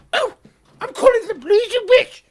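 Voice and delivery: very high, loud squealing screams; mock hysterical mother. Mother threatens to call police on her child, mock hysterical screaming. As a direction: imitate a mother shrieking in exaggerated panic.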